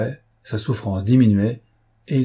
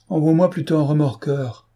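calling back; 6 semitones higher than usual. A narrator's reading. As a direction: call back over the water, wry. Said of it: "lui cria Pierre".

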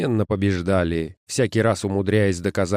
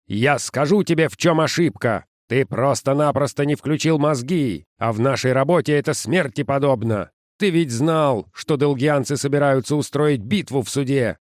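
raised, scolding lecture; angry boss; 5 semitones higher than usual. Acted. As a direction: tear into the subordinate.

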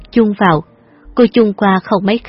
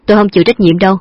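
ordinary delivery, nothing marked

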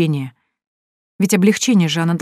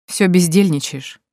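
mocking; slower than usual. A narrator's reading. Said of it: "с насмешкой бросил Вадим".

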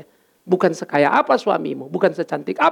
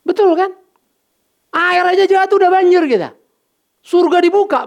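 much higher, louder preaching cry; preaching shout; 10 semitones higher, 5 dB louder than usual. Real, not acted. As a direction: call it out to the congregation. Preacher builds to climax. (berseru keras dan tinggi kepada jemaat)

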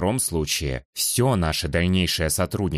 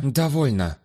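curt command; 6 semitones higher, slower than usual. Acted. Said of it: "больше похожая на команду собаки".

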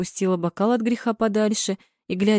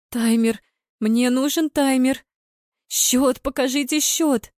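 higher, agitated wail of demand; 6 semitones higher, slower than usual. A narrator's reading. Marina begging the TV to show the score, wailing. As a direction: wail desperately at the screen. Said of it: "выла Марина".

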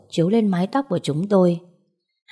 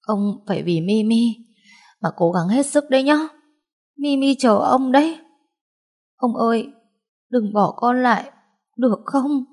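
higher, pleading voice near tears; tearful pleading; 6 semitones higher than usual. Acted. Pleading with dying grandfather.

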